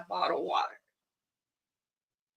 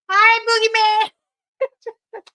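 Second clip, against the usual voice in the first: put-on much higher, louder, drawn-out childlike hi; slow sing-song greeting; 11 semitones higher, 8 dB louder, much slower than usual. [name] calling out to the creature, slow and high. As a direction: stretch it out like a toddler calling.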